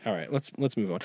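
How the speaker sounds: ordinary talk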